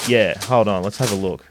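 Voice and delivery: ordinary delivery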